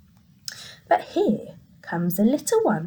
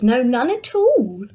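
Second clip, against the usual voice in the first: ordinary delivery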